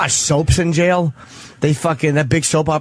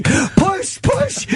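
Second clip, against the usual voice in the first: high mock yell, no real volume; squeaky, half-hearted yelled urging. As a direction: imitate yelling through laughter. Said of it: "he's yelling".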